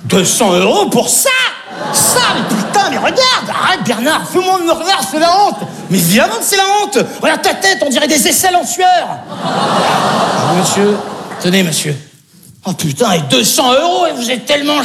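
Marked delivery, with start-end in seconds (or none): 1.9-9.1 shouting at Bernard. Acted. Wife yelling at Bernard over the haircut money, shouting. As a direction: berate him at full voice.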